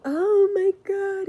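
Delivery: high, loud, hammed-up shocked wail; mock dramatic gasp. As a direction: overact the outraged victim. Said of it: "they go straight into acting".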